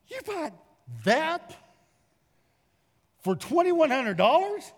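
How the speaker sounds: high disbelieving squeak, rising; incredulous. Retold or imitated. Telling his brother the price is crazy, incredulous high rise. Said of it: "I go".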